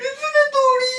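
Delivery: high, wailing whine; whiny crying. Real, not acted. Husband crying high on bhang.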